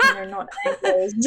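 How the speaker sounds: amused disbelief; laughing. Real, not acted.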